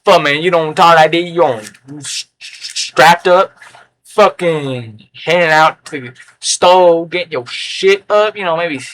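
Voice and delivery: mocking put-on street-slang voice; imitated slang talker. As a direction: imitate slang talk, stretching words out. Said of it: "I'm sorry if you talk like that".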